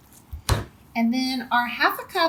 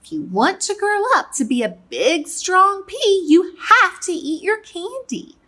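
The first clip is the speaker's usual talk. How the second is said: high, loud, emphatic parent urging; Papa Pea; 9 semitones higher, 5 dB louder than usual. Acted. Father telling his little pea to eat up, high and emphatic. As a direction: lay down the rule, raised and insistent. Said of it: "Papa Pea would say".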